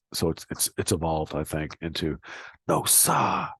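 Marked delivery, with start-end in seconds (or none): 2.7-3.5 high Boston "No, sir" with "sir" as "sa", exclaimed; Boston accent. Shown as a demonstration. Teacher closing with the Boston exclamation. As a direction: cry "No, sa!" Boston style. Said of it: "the pronunciation of sir is sa".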